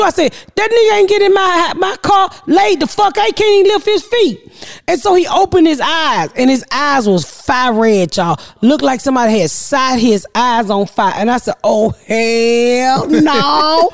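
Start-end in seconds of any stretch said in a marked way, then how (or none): 0.6-4.5 indignant refusal. Retold or imitated. Mother retells refusing to help responders. 11.6-13.9 very drawn-out, appalled exclamation; appalled refusal. Retold or imitated. Mother retells seeing son's red eyes, appalled.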